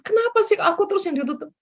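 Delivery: the same complaint, fast and high, with a laugh through it; laughing whine. Retold or imitated. The preacher repeats the believer's complaint, fast and high, laughing as she says it. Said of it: "Dan jangan seperti gini".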